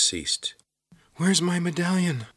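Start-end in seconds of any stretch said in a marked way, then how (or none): 1.2-2.3 high-pitched, panicked demand; frantic cry. A narrator's reading. Kate asks Corvin for her lost medallion, frantic cry. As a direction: cry out, desperate to find it.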